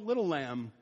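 put-on preacher softening over the little baby lamb; tender. Shown as a demonstration. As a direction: coo it gently, fading away. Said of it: "the word for lamb there is little".

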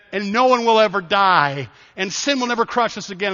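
impassioned preaching. Real, not acted. Preacher declaring his faith to the congregation, impassioned.

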